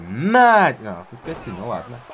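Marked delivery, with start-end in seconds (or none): 0.1-0.7 triumphant yell; shout. Real, not acted. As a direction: shout it as the move lands. Finisher lands in game.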